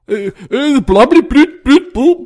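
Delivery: loud, high-pitched nonsense babble; mock gibberish dialogue. Critic mimicking dialogue nobody can follow, mock gibberish. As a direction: parody unintelligible film lines. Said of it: "det er altså bare sort".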